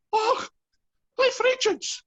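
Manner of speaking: high, loud mock Scottish yell; yelled Scottish accent. Scottish bandsmen yelling at a bandmate on spotting a star, mock Scottish yell. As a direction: shriek it out in an attempted Scottish accent. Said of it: "Scottish accent here ... they yelled at me".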